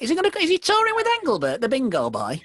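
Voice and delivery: gushing pensioner caricature, rattled off quickly; hammed-up old fan gossiping about the bingo boy. Mimicking pensioner fans after gig.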